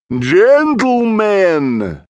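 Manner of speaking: long drawn-out call, high and loud; slow, ringing, mysterious summons. A narrator's reading. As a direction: stretch it out like a theatrical vampire. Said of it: "a mysterious voice echoed round the cellar".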